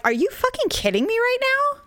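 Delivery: mock-incredulous, outraged at the starers; retold outrage. Retelling her outrage at staring couples, incredulous high voice. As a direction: pitch leaping way up in disbelief, same volume.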